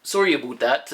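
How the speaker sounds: put-on "about" as "aboot" in a Canadian accent. Host apologising for a slip, "about" as Canadian "aboot". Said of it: "my Canadian accent came out there".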